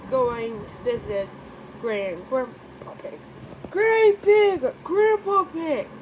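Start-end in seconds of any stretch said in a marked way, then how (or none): 3.7-5.9 high, loud excited calling out; excited greeting. Acted. Child greeting grandparents, high excited call. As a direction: call out to them with glee.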